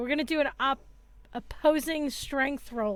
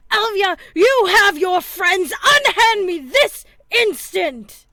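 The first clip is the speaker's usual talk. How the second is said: loud, high-pitched outraged demand; indignant complaint; 8 semitones higher, 13 dB louder than usual. Acted. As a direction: protest loudly at being manhandled, stretching the final words. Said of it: "you hear him really complaining".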